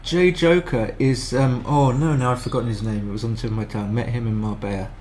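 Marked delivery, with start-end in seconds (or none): none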